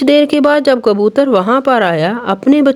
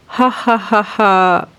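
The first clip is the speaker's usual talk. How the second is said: evil laugh; much slower than usual. Acted. Villain crane gloats.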